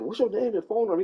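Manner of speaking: put-on high, prying old geezer voice; nosy old neighbor. The old neighbor questioning him at his truck, high prying voice.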